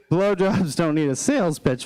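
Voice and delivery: ordinary talk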